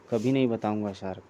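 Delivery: usual speaking voice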